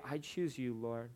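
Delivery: quiet prayer. Acted.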